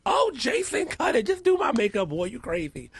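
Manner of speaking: high teasing dismissal. Retold or imitated. Eve teasing her starstruck stylist, high playful voice. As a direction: wave him off playfully. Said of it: "She was like".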